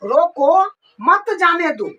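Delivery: रोको set apart, then मत जाने दो; pause after रोको. Shown as a demonstration. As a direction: break after रोको, keep मत जाने दो together. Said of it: "यानी रोको अभी". (रोको के बाद विराम)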